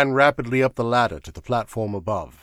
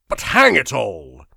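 slow, louder exasperated protest; 9 dB louder, slower than usual. A narrator's reading. Sir Walter breaking the dead silence, exasperated. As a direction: burst out, baffled.

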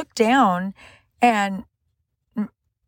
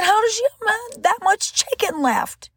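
high, slow, astonished question; stunned and baffled; incredulous; 11 semitones higher, slower than usual. Retold or imitated. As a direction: ask it in drawn-out disbelief, pitched way up. Stunned question to Reuben, retold.

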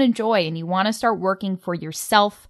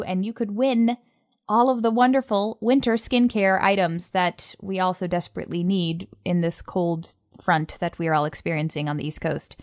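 ordinary delivery

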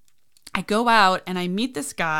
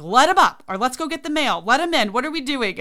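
loud, high excited nanny dad; imitating a worked-up dad; excited urging; 6 semitones higher, 6 dB louder than usual. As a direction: call out eagerly to let the guest in. Acting out nanny dad urging everyone.

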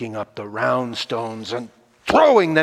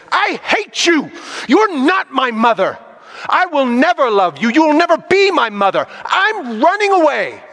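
put-on much higher and louder, a child screaming in rage; furious child's outburst; 13 semitones higher, 6 dB louder than usual. Boy yelling at his stepmother before running away, high furious shout. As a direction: hurl every word at her like a hurt, defiant boy.

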